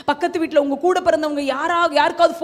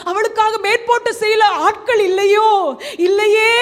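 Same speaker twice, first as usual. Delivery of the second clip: raised-pitch anxious lament; anguished worry; 5 semitones higher, 4 dB louder than usual. Acted. Preacher voices a parent fretting over a child's future, anguished. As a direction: voice a troubled parent's fretting questions. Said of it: "என்று கலங்குறீங்களா".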